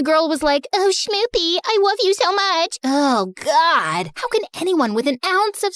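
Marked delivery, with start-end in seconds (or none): 0.7-2.8 mock baby talk. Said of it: "this couple started baby talking".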